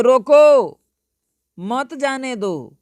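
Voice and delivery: रोको high and loud, pause, then slow high मत जाने दो; pause after रोको. Shown as a demonstration. Teacher means stop him, pause after रोको. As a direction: command to stop: punch रोको, break, stretch मत जाने दो. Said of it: "मतलब रोकने के लिए मैं कह रहा हूँ".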